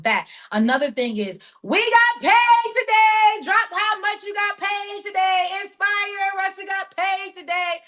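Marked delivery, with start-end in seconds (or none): 1.6-7.8 very high, drawn-out celebratory call; elated singsong announcement; excited payday cheer. Real, not acted. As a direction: holler it out slowly, stretching the words.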